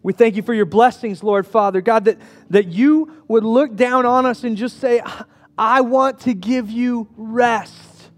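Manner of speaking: rising, fervent prayer voice. Acted. Pastor prays for the congregation, fervent prayer. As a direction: pray earnestly over the people.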